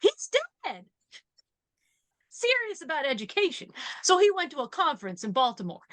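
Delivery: high-pitched sitcom secretary impression; quoted secretary. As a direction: play a secretary in a put-on high voice. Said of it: "the secretary's like".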